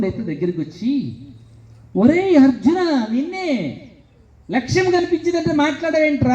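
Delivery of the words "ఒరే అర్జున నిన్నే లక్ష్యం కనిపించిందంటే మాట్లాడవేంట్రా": impatient shout. Retold or imitated. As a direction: shout the name, then demand an answer. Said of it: "అరుస్తున్నాను అప్పటి నుంచి". (గట్టిగా, అసహనంగా అరవడం)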